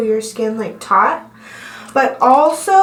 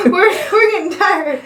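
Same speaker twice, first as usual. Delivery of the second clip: laughing; 7 semitones higher, 3 dB louder than usual. Real, not acted. Laughing while working.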